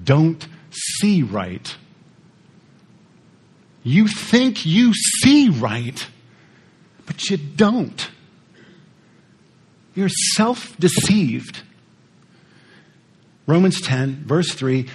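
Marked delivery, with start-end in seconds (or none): 0.0-11.6 slow, measured, softer words of a mentor; a hint of a calm mentor voice; mentor's slow verdict. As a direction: lay it out slowly like a patient mentor. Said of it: "He said".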